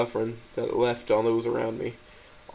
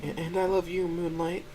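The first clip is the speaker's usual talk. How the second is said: put-on a girlfriend answering with a new pet name; high gentle loving voice; 6 semitones higher than usual. A narrator's reading. Stella's voice in the story.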